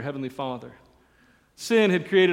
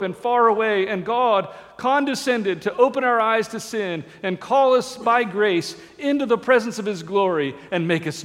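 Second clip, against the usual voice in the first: louder, higher, building sermon cadence; fervent preaching; 5 semitones higher, 4 dB louder than usual. Acted. Preacher urges the church toward the broken, at full force. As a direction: preach it to the back pew with conviction.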